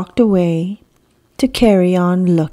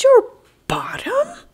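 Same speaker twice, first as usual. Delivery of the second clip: very high breathy whisper bird voice; squeaky bird. Acted. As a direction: play a small timid bird. Bird's voice.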